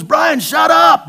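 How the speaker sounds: high, loud, slow shout of shut up; mock shout. Friend on the phone shouting to stop the preacher's babbling. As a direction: bellow it to cut off a rambling friend. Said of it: "He goes".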